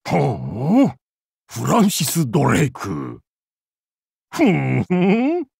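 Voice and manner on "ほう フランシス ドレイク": low, measured murmur of interest; intrigued appraisal. Acted. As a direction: drop low and savour the name. Sizes up a famous rival pirate.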